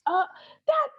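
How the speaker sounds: very high, halting mock squeal; amazed recognition. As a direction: act out the penny dropping, high-pitched. Retells recognising the impersonated actor.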